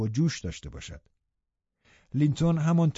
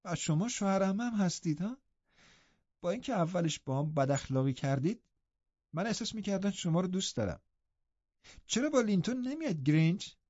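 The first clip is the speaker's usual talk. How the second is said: put-on lightly raised, softer girl's voice; eager young girl questioning her uncle; a lightly voiced lively girl; young Catherine; 7 semitones higher, 6 dB quieter than usual. A narrator's reading. Catherine voiced in read story.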